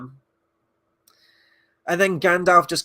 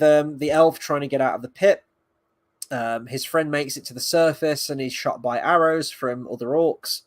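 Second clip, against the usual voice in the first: normal talking voice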